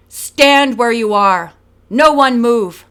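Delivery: slow, loud commanding call; loudspeaker order. A narrator's reading. Police ordering the gang over a loudspeaker. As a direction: bark orders through a loudspeaker. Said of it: "A voice over a loudspeaker ordered".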